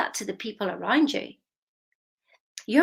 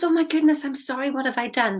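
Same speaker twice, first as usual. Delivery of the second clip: higher, louder flustered "oh my goodness"; shocked auntie; 6 semitones higher, 4 dB louder than usual. Retold or imitated. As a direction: auntie caught off guard by the crying child. I burst into tears.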